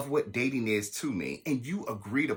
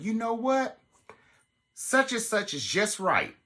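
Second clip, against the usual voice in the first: higher, slow mock of his line; mock boyfriend quote; 10 semitones higher than usual. Mocking her date talking to her son, higher. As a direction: mimic him siding with someone. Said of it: "Let me tell you who the fuck".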